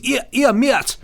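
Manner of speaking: a failed Geordie accent, high and loud; attempted Geordie accent. Shown as a demonstration. Host showing the only Geordie accent he can do, badly, high and loud. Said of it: "the only thing I can do is".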